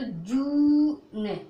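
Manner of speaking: "जू ने" with long oo, n set apart; sounded out. Shown as a demonstration. Teacher builds the word for learners, sounded out "जू ने". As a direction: draw out "जू", then release the n. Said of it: "ई नहीं पढ़ोगे last में".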